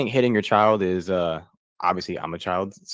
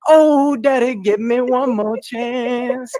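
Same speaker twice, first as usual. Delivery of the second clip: put-on high, slow begging little boy voice; pleading child [name]; 7 dB louder, much slower than usual. Comic [name] begging his dad in a squeaky, drawn-out plea. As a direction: whimper it like a kid begging for mercy.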